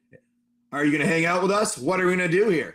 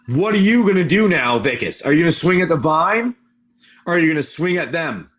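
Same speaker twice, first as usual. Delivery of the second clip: louder, pressing the player for a choice; loud urging; 2 semitones lower, 6 dB louder than usual. Acted. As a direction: push them hard to decide now. GM urges player to choose.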